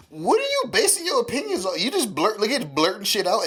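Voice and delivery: high-pitched, worked-up retold rant; irritated. Brother challenging his sister's blurted opinions, irritated. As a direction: challenge them in exasperation, pitch way up. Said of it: "I was getting irritated".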